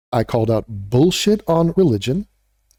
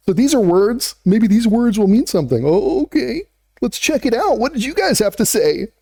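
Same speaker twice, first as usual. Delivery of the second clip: mock enthusiasm; 6 semitones higher than usual. Real, not acted. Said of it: "mock-excited look at comments".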